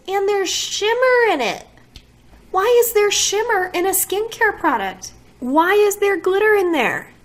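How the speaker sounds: high, loud, indignant questions; loud, high-pitched complaint about glitter; outraged disbelief. Real, not acted. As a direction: rant in real disbelief. Outraged by shimmer in skincare.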